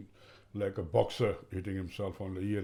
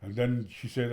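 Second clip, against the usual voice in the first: ordinary talk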